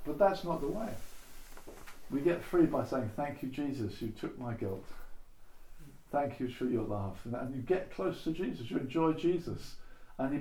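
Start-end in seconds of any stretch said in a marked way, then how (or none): 3.2-7.2 quiet prayer. Shown as a demonstration. A quiet sample prayer of thanks.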